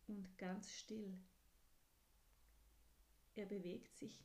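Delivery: soft, breathy hush of a storyteller; whispered narration. A narrator's reading. Narrator telling children about the curled-up hedgehog, whispering. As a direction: keep it still so the hedgehog isn't disturbed.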